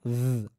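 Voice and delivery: "জ" as a buzzing voiced th. Shown as a demonstration. Teacher showing the second TH sound, voiced demo. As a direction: hold "জ" as a voiced z-like dh. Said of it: "ধয়ের মতো হয়".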